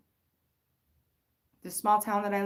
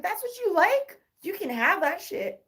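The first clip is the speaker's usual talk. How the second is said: dismissive; 10 semitones higher, 3 dB louder than usual. Retold or imitated. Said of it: "I was like".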